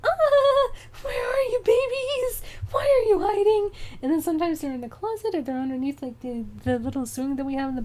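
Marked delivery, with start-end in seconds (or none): 0.0-3.7 very high, loud sing-song call; calling the cats. Retold or imitated. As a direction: call out to the hidden babies, pitched way up.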